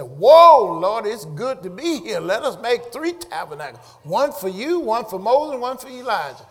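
preacher acting out an excited apostle; awestruck Peter. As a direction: exclaim it as an overwhelmed disciple. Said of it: "And Peter said".

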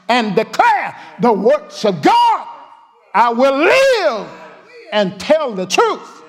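slow, high, loud ringing vow; thundering pulpit declaration; preaching shout. Real, not acted. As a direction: stretch each word out as a battle cry.

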